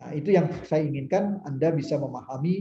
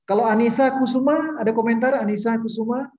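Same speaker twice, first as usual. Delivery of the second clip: raised voice, calling a name; 7 semitones higher, 5 dB louder than usual. Real, not acted. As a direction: call her name loudly. Teacher calling a silent student.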